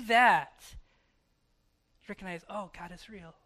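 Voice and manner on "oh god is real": normal talking voice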